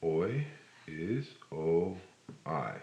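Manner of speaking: "O" and "I" spelt slowly, letter by letter; slow teacher spelling. Shown as a demonstration. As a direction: spell out "O" then "I", slowly, with pauses. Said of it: "one of the first ways you can make".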